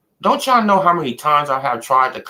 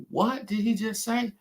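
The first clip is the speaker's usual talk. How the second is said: stunned disbelief; 11 semitones higher, 9 dB quieter than usual. Retold or imitated. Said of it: "I said".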